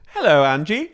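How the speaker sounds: high, put-on British greeting; [name]'s British accent. [name] greeting Angie, mock British accent. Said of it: "goes back into a British accent".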